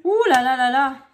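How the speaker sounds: a loud, weary ouh là là; exasperated sigh. Real, not acted. Fed up with the kids gaming online, loud exasperated groan.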